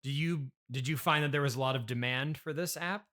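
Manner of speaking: ordinary talk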